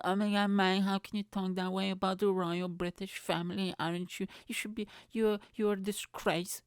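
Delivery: hammed-up prim royal defender; mock outraged royalist. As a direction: tut at him like an offended loyal Brit. Imagined royalist scolding him.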